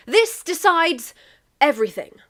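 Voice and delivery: grand declaration. Acted.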